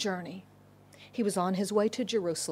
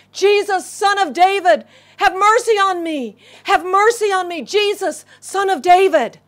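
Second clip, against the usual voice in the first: loud, high, desperate cry for mercy; calling out; 8 semitones higher, 9 dB louder than usual. Acted. Blind beggar calls out to Jesus for mercy, loud cry. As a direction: shout it to be heard over a crowd. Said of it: "he starts to call out".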